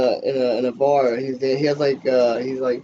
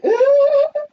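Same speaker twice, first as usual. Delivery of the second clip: high burst of laughter; 7 dB louder than usual. Real, not acted. Cracks up at scene.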